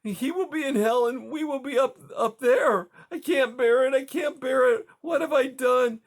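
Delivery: high, loud, tearful despair; despairing sobs. Acted. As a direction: break down, voice high and crying. Widow breaking down in despair.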